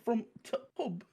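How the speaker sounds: high mock Yorkshire; broad Emmerdale with a stopped "t'"; Emmerdale Yorkshire accent. Repeating the Emmerdale joke, mock Yorkshire accent. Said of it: "everyone from Emmerdale just sounds like that".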